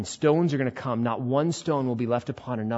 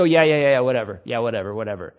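dismissive brush-off; 3 semitones lower, 4 dB louder, faster than usual. Acted. Acted brush-off of the warning.

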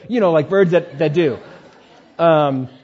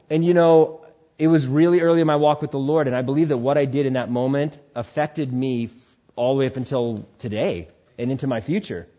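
ordinary talk, nothing marked

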